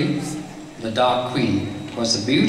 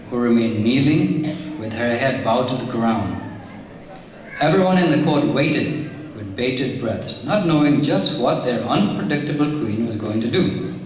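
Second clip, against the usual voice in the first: usual speaking voice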